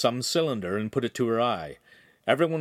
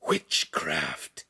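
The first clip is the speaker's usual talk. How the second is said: low, breath-filled gasp of horror; 6 semitones lower than usual. A narrator's reading. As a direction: gasp the word in shock, pitch dropped. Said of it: "he gasped".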